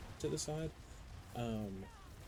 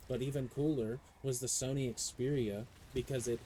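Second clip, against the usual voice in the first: usual speaking voice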